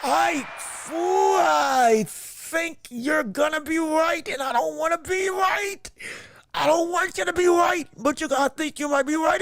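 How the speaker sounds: words pushed through clenched teeth; talking through teeth. Real, not acted. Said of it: "I'm talking through my teeth".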